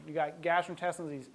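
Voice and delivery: ordinary delivery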